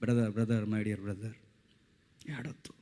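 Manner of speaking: low, very soft acted plea; hushed sisterly plea. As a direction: quietly hush a brother, sinking to a whisper. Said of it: "Priyanka Gandhi అంది". (మెల్లగా, గుసగుసగా బతిమాలడం)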